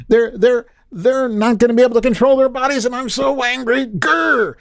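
very high, hammed-up whiny mock outrage; mock Elizabeth Warren. Host mocking a senator on The View, squeaky fake outrage. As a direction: sneer at her fake fury in a squeaky voice. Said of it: "Elizabeth Warren's on The View, and she's like".

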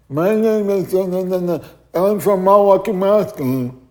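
high, loud imitation of a deaf man's speech with blurred words; imitating deaf speech. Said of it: "but he was deaf".

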